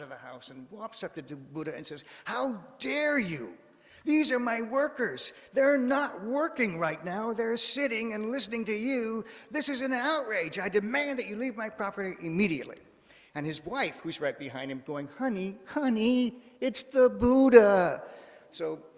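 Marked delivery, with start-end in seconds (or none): 2.2-12.8 high, loud storyteller's outrage; acted-out indignant rage, hammering each phrase; enraged farmer. Rich farmer confronting Buddha over his idle workers, enraged. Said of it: "becomes enraged. And he storms out". 15.2-18.0 high, loud, drawn-out words; hammed-up frantic wife, stretching each word; urgent wifely plea. Wife behind her raging husband, urgent loud plea.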